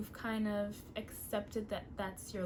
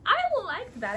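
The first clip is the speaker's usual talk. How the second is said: higher, louder, pleased with herself; delighted, louder second take; excited repeat; 10 semitones higher, 9 dB louder than usual. Real, not acted. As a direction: say it again with a delighted lift.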